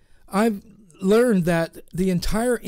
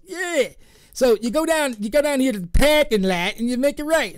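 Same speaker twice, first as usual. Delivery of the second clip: Boston local; 5 semitones higher than usual. Retold or imitated. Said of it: "He goes".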